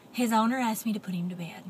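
ordinary delivery

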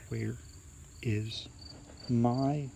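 slow, word-by-word spooky groan; long suspenseful gap after each word, last word rising; hushed, eerie creature closing in; groaning ghostly voice. A narrator's reading. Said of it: "the voice groaned".

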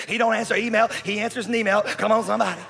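loud, high, rattled-off preaching; high-energy pulpit patter; rousing church slogans. Acted. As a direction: fire off church catchphrases with gusto. Mimics churchgoer's slogans at full force.

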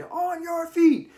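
drill instructor shout. Retold or imitated. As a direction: roar it across the parking lot. Drill instructor barking orders.

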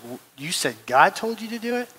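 imitated tearful question, soft and rising; a hushed, mildly tearful retold question; retold tearful disbelief. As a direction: lightly mimic a crying woman's astonished question. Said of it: "she just starts bawling and crying".

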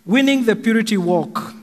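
ordinary talk, nothing marked

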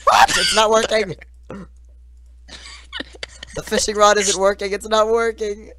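loud frustrated yell; frustrated shouting. Real, not acted. Item fails mid-chase.

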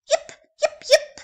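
high, sharp little puppy barks; puppy yips. Acted. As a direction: yip like an excited pup. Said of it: "barked the gingerbread pup".

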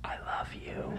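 soft tender whisper. Pretend. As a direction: breathe it to sleeping kids.

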